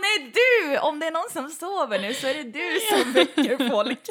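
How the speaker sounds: high, loud incredulous comeback; amused mock protest; laughing retort. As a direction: throw it back through laughter. Retort to the shouting man, laughing.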